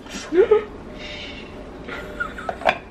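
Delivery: pained giggle after a burn; high laughing squeal. Real, not acted. Laughs after burning herself.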